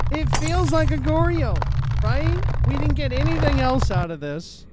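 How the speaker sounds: real annoyed yell at the game; frustrated shout. Real, not acted. Frustrated gamer hunting ghost type.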